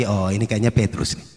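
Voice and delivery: low, soft gossiping; hushed church gossip; gossipy aside. Acted. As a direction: whisper the gossip to a neighbour.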